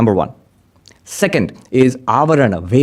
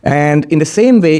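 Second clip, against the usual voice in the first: usual speaking voice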